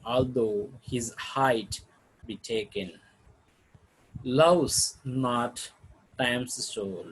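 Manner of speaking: slow, measured sonnet reading; poem recital. Acted. Teacher reciting a sonnet to the class, measured and slow. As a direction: read the sonnet with care, line by line.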